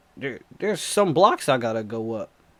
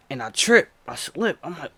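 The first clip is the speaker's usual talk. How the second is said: ordinary delivery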